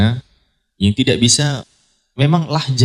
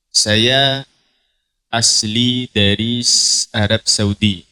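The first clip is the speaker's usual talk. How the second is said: put-on slow Arabic-accented Indonesian; slow Arabic accent with a crisp dal; much slower than usual. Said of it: "Dal, dalnya begitu".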